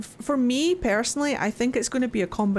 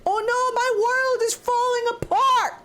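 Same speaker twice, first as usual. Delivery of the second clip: much higher, squealing mock despair; cartoonish shriek of doom; hammed-up panic. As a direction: wail in comic dismay. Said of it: "He's like".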